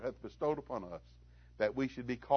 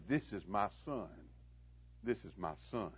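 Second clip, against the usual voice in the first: tender murmur; 6 semitones lower, 13 dB quieter than usual. Retold or imitated. Father proudly murmurs about son.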